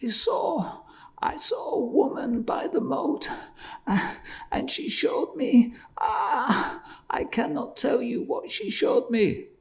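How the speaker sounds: high, whispered, sobbing fear; terrified man tells the peddler. A narrator's reading. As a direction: whisper it shaking with terror. Terrified man recounts the faceless woman.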